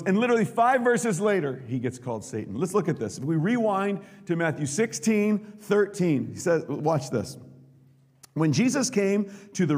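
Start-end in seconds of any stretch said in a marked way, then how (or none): none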